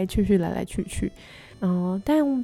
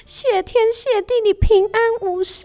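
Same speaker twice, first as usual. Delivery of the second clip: much higher, panting relieved heron; out of breath; 13 semitones higher, 4 dB louder than usual. Acted. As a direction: gasp it out after running. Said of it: "气喘吁吁，上气不接下气".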